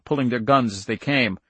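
usual speaking voice

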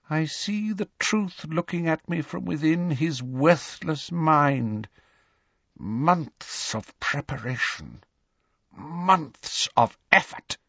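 measured, icy disappointment; cold villain. A narrator's reading. Voldemort coldly reproves his follower.

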